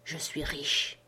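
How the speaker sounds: low, soft hushed voice, barely above a whisper; hushed secret. A narrator's reading. Ben Gunn lowers his voice to tell Jim, hushed. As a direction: lean in and confide it low, like a secret. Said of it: "me dit dans un chuchotement".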